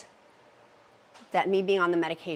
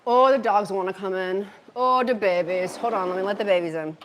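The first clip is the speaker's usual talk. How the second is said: big loud cooing at the dogs; doting on the dogs; 6 dB louder than usual. Real, not acted. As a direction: call out fondly to the dogs, loudly. Fussing over dogs wanting in.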